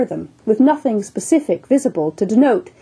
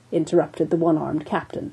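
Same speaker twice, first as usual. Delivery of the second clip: usual speaking voice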